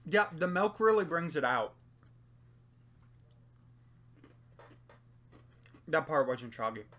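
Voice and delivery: speaking between bites; chewing while talking. Real, not acted. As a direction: mouth partly full of cookie. Seen 2: Bit cookie at -9; still holding it, mouth working while speaking.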